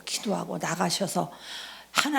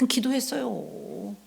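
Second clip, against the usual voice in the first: ordinary talk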